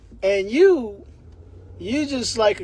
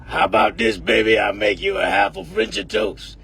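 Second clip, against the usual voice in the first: gruff bossy grandma. Retold or imitated. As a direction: bark it out like a tough old lady. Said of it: "a voice like that".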